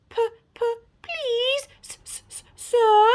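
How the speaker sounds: high, stammering little werewolf; timid pleading. A narrator's reading. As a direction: stutter a frightened plea to the headmaster. Pupil pleads with ogre headmaster.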